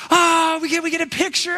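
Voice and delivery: high, loud eager call of a beachgoer; imitating excited onlookers begging for a photo; excited crowd voice. Beachgoers calling out to the boy, high and eager.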